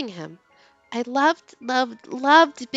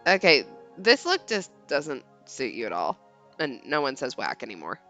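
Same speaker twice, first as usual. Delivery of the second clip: put-on low, dry teenage boy; low deadpan boy; 11 semitones lower, 4 dB quieter than usual. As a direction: shoot her down flatly. Leon voiced in read story.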